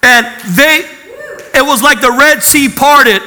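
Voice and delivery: loud, high testimony preaching; a preacher testifying to his congregation in full voice; preaching at full force. Acted. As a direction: preach it, hallelujah rising. Testimony climax preached loudly.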